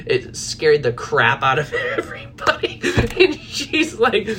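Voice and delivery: high, breaking into real laughter; laughing while talking. Real, not acted. Coach telling how the scream scared shoppers, laughing while talking. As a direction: crack up helplessly through the words. Cracking up at her own story.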